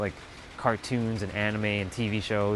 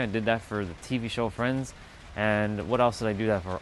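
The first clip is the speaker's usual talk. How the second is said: ordinary talk, nothing marked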